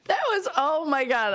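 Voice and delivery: normal talking voice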